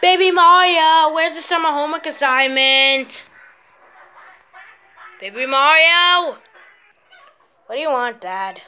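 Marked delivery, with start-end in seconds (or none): 0.0-6.4 calling out. Acted. Dad calling his son.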